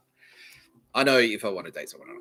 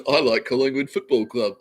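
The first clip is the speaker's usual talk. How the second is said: dumbed down; 4 semitones higher, 6 dB louder than usual. Pretend. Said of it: "how do I fucking dumb it down".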